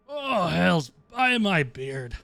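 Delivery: booming dwarf oath, stretched out; gruff dwarf exclaiming. Acted. GM voices the dwarf Thrumal.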